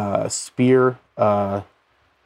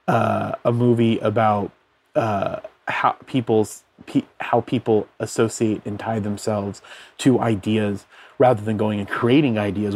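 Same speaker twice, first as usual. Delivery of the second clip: ordinary talk, nothing marked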